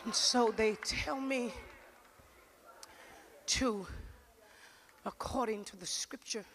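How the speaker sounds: slow, low and soft preaching; measured preacher, long pauses between short phrases; solemn preaching. Real, not acted. Slow, solemn opening of sermon.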